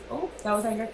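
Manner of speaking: ordinary talk, nothing marked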